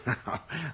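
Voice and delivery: quick amused chuckle; a soft rising laugh; light amused laugh; short laugh. Acted. Dirk laughs at the question.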